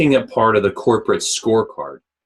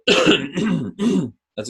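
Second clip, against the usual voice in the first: clearing a scratchy throat; 8 semitones higher than usual. Real, not acted. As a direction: speak through a throat clear. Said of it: "Excuse me, I'm coughing here in your ear".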